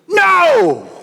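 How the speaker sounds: a sudden high, sharp No; shouted no. Retold or imitated. Pastor imitating kids shouting in the song, high shout. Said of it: "shout no with that part".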